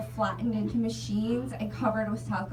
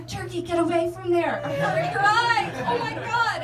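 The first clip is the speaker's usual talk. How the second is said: higher, louder mock panic; freaking out; 8 semitones higher, 7 dB louder than usual. Warning the turkey off the menu, in loud panic. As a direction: shoo the turkey away in a comic panic. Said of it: "I'm legit freaking out".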